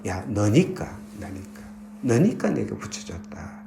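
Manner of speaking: low, soft reply from God; retold divine voice, gently hushed; God's quiet answer. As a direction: answer an outcry softly and simply. God answers the crying pastor.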